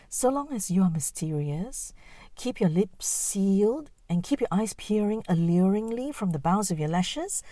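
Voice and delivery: lightly voiced scheming auntie; sly auntie voice. Voices an elder woman's advice.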